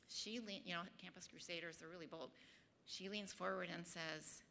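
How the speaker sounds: ordinary delivery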